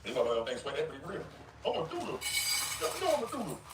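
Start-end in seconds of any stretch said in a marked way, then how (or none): 1.6-3.6 high, silly singsong mockery of a rival; mocking high goofy voice. Streamer mocking a grown man's boasting, high goofy voice. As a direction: parody his boast in a dopey high voice. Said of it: "talking like this".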